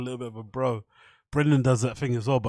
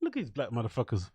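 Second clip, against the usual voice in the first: sneering aside; 4 semitones lower, 9 dB quieter than usual. Retold or imitated. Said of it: "gives Brian the look like".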